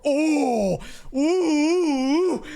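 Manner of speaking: long, high-pitched "oh" and "ooh" of real hype; excited oohing. Real, not acted. Streamer reacting to Ninja Gaiden four, thrilled high oohs. Hyped reaction to game rumour.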